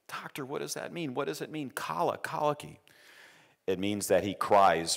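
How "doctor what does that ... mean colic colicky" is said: high, soft desperate asking; pleading parent. Retold or imitated. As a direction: beg the doctor for an answer, pitched up.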